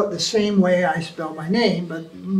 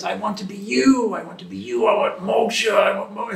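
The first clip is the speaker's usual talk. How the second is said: mock Mayavadi voice. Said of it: "all that is exuberating".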